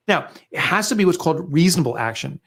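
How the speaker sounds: usual speaking voice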